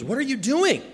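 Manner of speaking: high-pitched acted challenge to a friend; alarmed disbelief. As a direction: imitate a friend shocked at what he sees. Acts out alarmed friend confronting swimmer.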